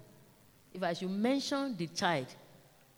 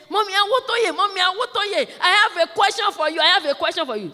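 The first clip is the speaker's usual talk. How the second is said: put-on high, loud child clamouring for attention; insistent child; 11 semitones higher, 10 dB louder, faster than usual. Child interrupting teacher with a question, insistent high calling. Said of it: "And the child said".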